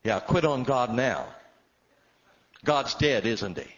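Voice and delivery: loud, high, sarcastic rebuke; stern scolding. Retold or imitated. Wife sternly mocks her husband for quitting, loud and sarcastic. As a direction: scold him loudly for giving up. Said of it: "a stern look and she said".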